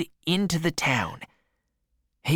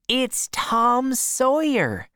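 higher, slow proud reveal; triumphant aunt; 7 semitones higher, slower than usual. A narrator's reading. Aunt revealing the boy to her husband, higher and slow. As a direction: savour the surprise.

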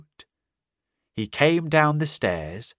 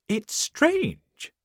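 much higher fussy woman's voice; landlady voice; 13 semitones higher than usual. Acted. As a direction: voice her high and puzzled. Missus Hall voiced high.

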